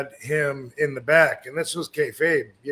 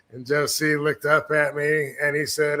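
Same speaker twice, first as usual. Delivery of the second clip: ordinary talk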